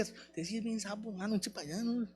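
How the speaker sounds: muttered aside. Retold or imitated. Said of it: "Igual, uno dice".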